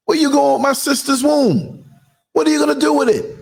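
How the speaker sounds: high, fast outraged questioning; mock outrage. Questioning the surgeons in mock outrage. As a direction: demand it like you can't believe it.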